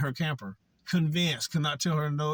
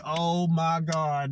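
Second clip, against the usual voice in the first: slow, drawn-out higher oh my God; exasperated disbelief; 4 semitones higher, 5 dB louder, much slower than usual. Retold or imitated. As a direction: stretch it out, fed up. Reacting to paranoid detox patient.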